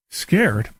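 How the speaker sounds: normal talking voice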